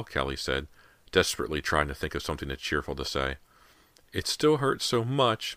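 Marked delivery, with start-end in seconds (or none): 4.1-5.6 much higher, slow whining complaint; mother complaining of her hurting arm. Acted. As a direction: moan it out slowly in pain. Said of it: "Missus Fraser complained".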